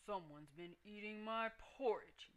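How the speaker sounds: lightly voiced bear father, cross; Papa Bear yelling. A narrator's reading. Papa Bear finds his porridge eaten, mock gruff yell. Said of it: "yelled the Papa Bear".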